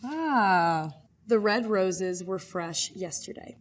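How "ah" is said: usual speaking voice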